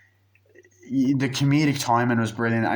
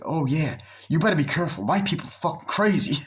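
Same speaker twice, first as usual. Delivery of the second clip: higher, animated warning voice; playing the worried buddy; friend's warning; 6 semitones higher, faster than usual. Friend on the phone warns him, higher and animated.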